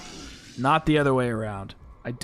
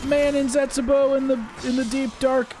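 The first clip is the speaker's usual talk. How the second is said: high, wimpy mock-English YouTuber voice; Mister Dalek; 10 semitones higher than usual. Streamer mimicking an English YouTuber's video intro, wimpy impression. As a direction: put on a high-pitched attempt at an English vlogger's intro. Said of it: "Mister Dalek is like".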